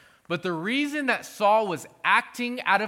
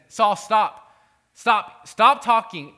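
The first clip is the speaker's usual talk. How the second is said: louder stern command, rising in pitch; Samuel's stern stop; 4 semitones higher, 8 dB louder, slower than usual. Retold or imitated. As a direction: halt him firmly, like a prophet cutting him off. Preacher voices Samuel rebuking Saul.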